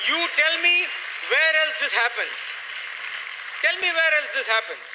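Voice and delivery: rousing challenge. Real, not acted.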